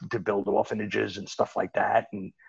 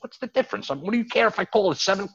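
pushy caller; 12 semitones higher, 5 dB louder, faster than usual. Retold or imitated. Caller pushing for late bet.

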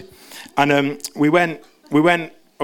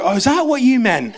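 high, loud dawning realisation; mock surprised parents; 10 semitones higher, 3 dB louder than usual. Parents understanding their son at last, high and surprised. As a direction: play the parents suddenly getting it.

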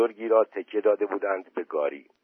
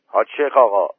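raised, calling voice; repeated loud call; 8 dB louder, faster than usual. A narrator's reading. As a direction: call his name out loudly. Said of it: "سید آبادی دوباره گفت". (صدا زدن با صدای بلند)

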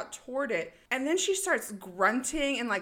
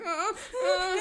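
mock gasping; 10 semitones higher than usual. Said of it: "and she's like".

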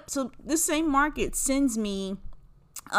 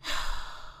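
heavy sigh; 8 dB quieter than usual. Real, not acted. Letting the grudge go.

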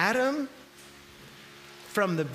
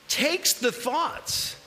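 ordinary talk

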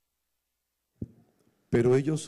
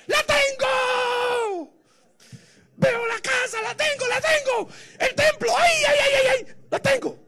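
high, full-force jubilant cry; victory shout; 10 dB louder than usual. Shown as a demonstration. Preacher showing a cry of faith to claim God's promise, jubilant shout. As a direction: shout it out as if already received. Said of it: "Estoy gritando que el Señor es victorioso".

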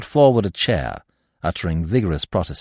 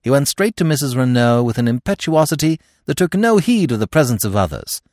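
ordinary delivery

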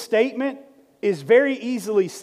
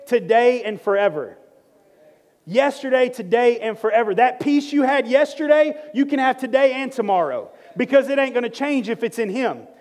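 preaching; 4 semitones higher than usual. Acted. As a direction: build the sermon up with fervor. Preaching builds up.

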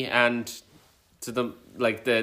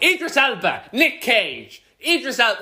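high excited name-dropping; mock excitement; 7 semitones higher than usual. Podcaster naming actors not characters, mock-excited. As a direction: call out the stars' names in mock excitement.

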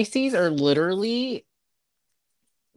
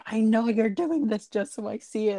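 raised pitch, no real shouting; retold mock scream; 6 semitones higher than usual. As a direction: imitate a friend's screamed accusation, mildly. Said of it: "you were screaming".

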